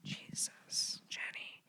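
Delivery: breathy unvoiced whisper; a whispered self-reproach. Real, not acted. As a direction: scold yourself in a whisper. Self-scolding aside.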